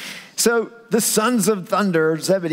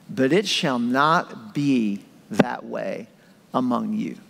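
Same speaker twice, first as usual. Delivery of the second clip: lower, slow, firm but tender; stern and loving; 5 semitones lower, slower than usual. Retold or imitated. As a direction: set them straight with love. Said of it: "with a sternness and a love".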